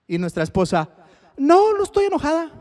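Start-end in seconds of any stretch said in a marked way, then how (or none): 1.4-2.5 higher, sing-song wife voice insisting; playful high wife impression; mock wife's denial. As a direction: imitate the wife who clearly is upset. Wife voiced denying anger.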